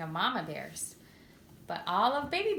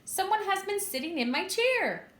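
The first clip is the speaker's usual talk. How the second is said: a loosely played father bear, pitched higher; Papa Bear's great big voice; 10 semitones higher than usual. A narrator's reading. Narrator reading to children as Papa Bear, raised cry. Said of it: "He cried out in his great big voice".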